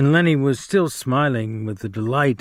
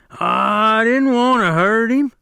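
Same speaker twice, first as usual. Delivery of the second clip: very high, slow, louder tearful plea; crying; 10 semitones higher, 4 dB louder, slower than usual. Acted. Lennie begging after crushing Curly's hand, slow high wail. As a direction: wail it out helplessly. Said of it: "Lenny cried".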